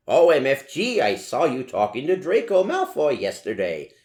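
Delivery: excited. Acted. Willow teasing Ebony about Draco, excited and loud. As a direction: burst out with thrilled gossip. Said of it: "she said excitedly".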